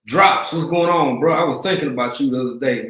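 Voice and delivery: excited shout-out. Real, not acted. Greets a returning viewer.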